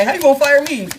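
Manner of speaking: hammed-up fired-employee protest; indignant mock outburst. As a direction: act outraged at being fired. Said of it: "I'm like".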